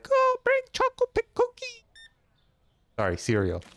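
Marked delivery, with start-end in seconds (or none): 0.0-1.8 squeaky high mom voice, pushed louder; a shrill-pitched put-on mom; mock mom demand. Cashier voicing a customer's wife at home, high mock nag. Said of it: "the mom's like".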